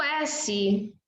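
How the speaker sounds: final "S" as a long voiceless sss; S phoneme. Shown as a demonstration. Teacher demonstrates the phoneme of letter S, sustained sss. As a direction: sustain the "S" as a pure sss, no vowel. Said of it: "vai representar o fonema S".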